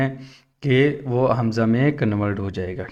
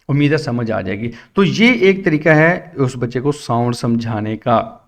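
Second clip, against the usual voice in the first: ordinary talk, nothing marked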